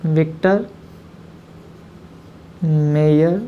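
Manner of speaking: teacher dictating while writing on the board; dictating slowly. Shown as a demonstration. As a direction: split into separate words. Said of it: "Hindi में भी इसको ऐसे लिखते हैं".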